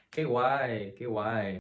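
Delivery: qué guay loose, g dropped to way; casual slang. Shown as a demonstration. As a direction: toss it off like a teen. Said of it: "speaking fast and informally".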